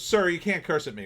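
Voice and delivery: indignant scolding. Retold or imitated. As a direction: imitate an affronted clerk telling off a customer. Offended clerk scolding the customer.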